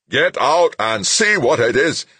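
high, loud, drawn-out shout; loud commander at full pitch, slowed for force; screamed order. A narrator's reading. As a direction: scream the order at your driver. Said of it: "Giap screamed at his own driver".

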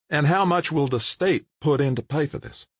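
a pointed question from the mayor; mayor's voice. Acted.

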